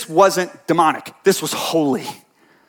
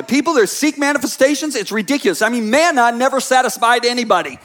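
ordinary talk

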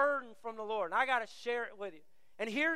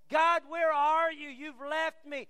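higher, drawn-out angry cry to God; mad at God; 4 semitones higher, 6 dB louder, slower than usual. Acted. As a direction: protest loudly to God, feeling abandoned. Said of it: "we get mad at God".

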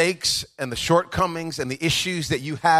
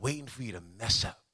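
usual speaking voice

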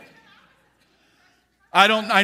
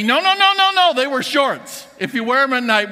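high, loud, insistent no no no; imitating a flustered denial, pitched way up; indignant protest; 5 semitones higher, 4 dB louder than usual. Pastor Joey insists to the preacher they were shorts, in a loud high protest.

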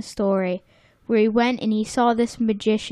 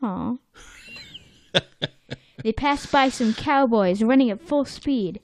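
ordinary delivery

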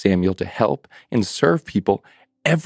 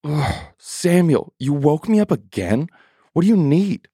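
irritated parent; 6 semitones higher than usual. Acted. Eli woken again, exasperated. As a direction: sound woken up one too many times. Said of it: "Eli was no different".

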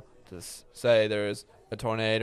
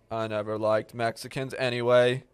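normal talking voice

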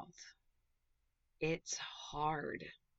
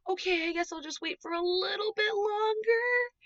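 very high, louder squeaky character voice; elephant giving in; 6 dB louder than usual. Acted. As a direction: squeak it out like a storybook elephant caving in. Elephant comes back, gives in.

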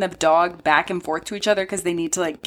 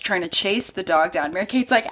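usual speaking voice

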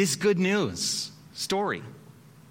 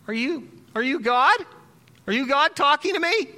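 nervous question; 8 semitones higher, 4 dB louder than usual. Acted. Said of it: "Freddie says".

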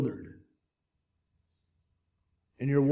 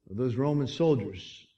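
ordinary talk, nothing marked

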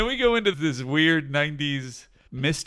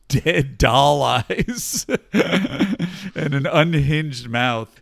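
laughing; 3 dB louder, much slower than usual. Real, not acted. Laughing while describing the dog.

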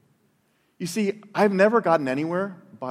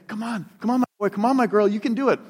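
put-on higher, eager come-on to a toddler; coaxing parent; 6 semitones higher, 3 dB louder than usual. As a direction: cheer the baby toward you, arms wide. Parent coaxing child to walk.

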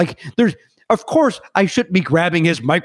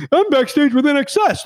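starstruck gushing; 11 semitones higher than usual. Retold or imitated. Starstruck sycophant self.

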